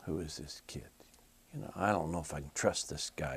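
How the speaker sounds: unvoiced, hushed and wary; breathless conspiratorial whisper, a bit quick; suspicious whisper. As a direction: whisper it like a paranoid king. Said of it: "like this".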